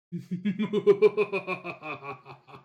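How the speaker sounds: loud evil laugh on request; villain laugh. Shown as a demonstration. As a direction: give the evil laugh again. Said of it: "I can do that for you again".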